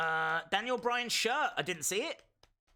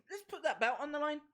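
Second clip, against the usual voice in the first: fast, much higher announcement; hyped announcer; 8 semitones higher, faster than usual. Acted. Streamer announcing title on the line, fast and high. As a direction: call it out like a ring announcer.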